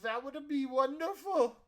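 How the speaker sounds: high-pitched, delighted character voice; delighted innkeeper. Acted. Cook gladly accepting help with the prep, delighted high voice. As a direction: sing out thanks in a high voice.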